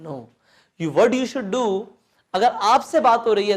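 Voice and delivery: normal talking voice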